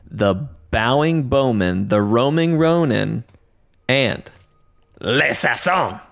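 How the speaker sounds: grand ring-announcer build, stretching the final name; hype announcer introduction. Acted. Host introducing his co-host with silly titles, hype announcer style. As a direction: roll out each title, drawing out the last.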